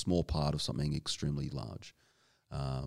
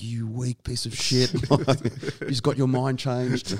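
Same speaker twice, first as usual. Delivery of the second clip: high, loud mocking jeer, drawn out through laughter; laughing mock-outraged taunt; 9 semitones higher, 6 dB louder than usual. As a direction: imitate mates piling on, stretching the words slowly. Teammates jeering in retold banter.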